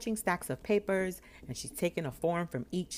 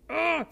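high, loud "ugh"; disgusted groan; 14 semitones higher, 8 dB louder than usual. Retold or imitated. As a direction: groan in mock dismay. Dreading the clerk she got.

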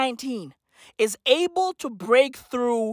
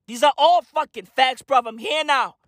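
ordinary delivery

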